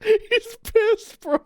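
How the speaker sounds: high-pitched, squeaky laughing voice; cracking up. Real, not acted. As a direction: squeal it through real laughter. Cracking up over the angry cat.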